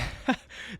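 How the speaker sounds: high, airy little laugh; short high unvoiced chuckle; breathy laugh. Real, not acted. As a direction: laugh at himself. Laughs at changing his top three.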